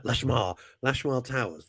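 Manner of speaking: usual speaking voice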